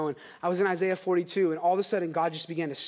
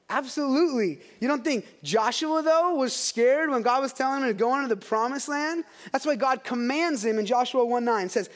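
normal talking voice

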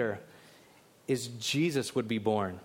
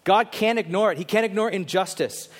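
ordinary delivery

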